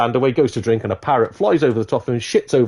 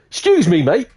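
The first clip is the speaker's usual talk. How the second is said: louder, higher acted call for attention; indignant confrontation; 7 semitones higher, 5 dB louder than usual. Customer confronting the piano player, loud and indignant. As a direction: accost him with raised voice.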